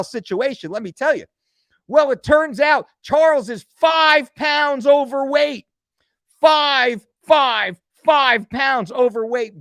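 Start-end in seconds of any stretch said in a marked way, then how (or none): 1.9-9.5 angry shout. Retold or imitated. Angry reveal of the weight.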